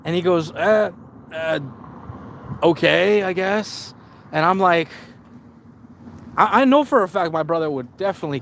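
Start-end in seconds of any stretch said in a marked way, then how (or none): 0.5-3.9 higher-pitched hedging with pauses between words; hesitant seller. Retold or imitated. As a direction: imitate a reluctant, unsure answer. Seller hedges on the truck's condition.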